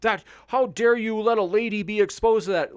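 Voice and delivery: high, loud, prissy gallant outrage; hammed-up high outrage on a lady's behalf. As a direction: parody an offended chivalrous defender. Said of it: "it comes off as a little patronizing when you're like".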